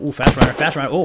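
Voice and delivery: ordinary delivery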